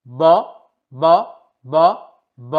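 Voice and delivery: ব with lips together and hardly any air; B sound demo. Shown as a demonstration. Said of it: "বি এর উচ্চারণ করবেন". (ঠোঁট মিলিয়ে কম বাতাসে ব উচ্চারণ)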